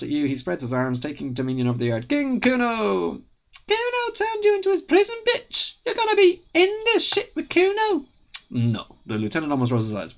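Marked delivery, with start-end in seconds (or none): 2.1-8.1 much higher, triumphant brat; gloating kid king. Acted. Kuno spreading his arms over the yard, gloating.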